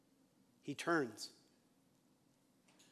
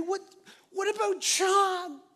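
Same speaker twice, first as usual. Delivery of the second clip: whiny Peter; 10 semitones higher, 3 dB louder, slower than usual. Retold or imitated. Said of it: "Peter's kinda saying".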